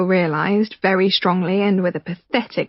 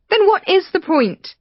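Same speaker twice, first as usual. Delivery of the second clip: much higher, pushing back; Rachel challenging; 11 semitones higher than usual. Acted. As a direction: demand an answer, high.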